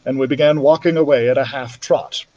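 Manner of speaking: usual speaking voice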